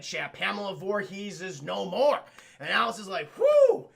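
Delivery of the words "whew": high, loud "whew"; exaggerated phew; mock relief. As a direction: imitate a big relieved whoop. Alice relieved after the kill.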